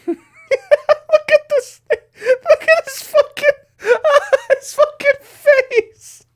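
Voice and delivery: high squealing through laughter; laughing. Real, not acted. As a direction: crack up, slowly, high. Laughing at snake face.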